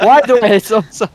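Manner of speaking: high, loud talk broken by laughter; helpless laughing through the words. Real, not acted. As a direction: crack up while trying to speak. Cracking up mid-sentence during play.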